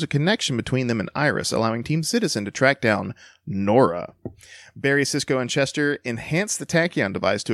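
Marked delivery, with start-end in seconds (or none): 3.4-4.1 NORA set apart and drawn out like a quotation; air-quote inflection. Shown as a demonstration. Co-host reading the recap doubts the name, air-quote inflection. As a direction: put audible air quotes round NORA. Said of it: "weird inflection in my voice".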